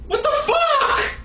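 high, drawn-out exasperated cry; exasperated outburst. Acted. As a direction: pitch it up and stretch it in disbelief. Exasperated at the comments.

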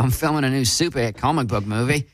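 hammed-up Aussie accent; Australian accent. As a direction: put on a cheery "crikey" Australian accent. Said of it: "Just work on an accent".

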